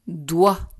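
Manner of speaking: "doigt." said alone, firm and loud; dictated syllable. Shown as a demonstration. Teacher dictates the second syllable to pupils, loudly articulated. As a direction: punch out "doigt." as one clear syllable. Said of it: "Deuxième syllabe".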